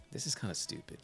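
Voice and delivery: whispered under the breath; whispered, bored remark; muttered aside. Retold or imitated. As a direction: mutter it to yourself, unimpressed. Bored at home watching a movie.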